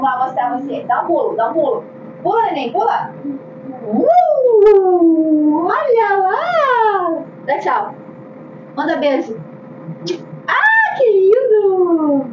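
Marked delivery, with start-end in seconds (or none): 2.2-3.1 high, eager cheer; excited encouragement. Real, not acted. Cheering toddler to jump. 3.9-7.2 high, slow, amazed coo; delighted. Real, not acted. Marvelling at toddler. 10.5-12.3 high, slow, touched coo; tender. Real, not acted. Moved by baby waving.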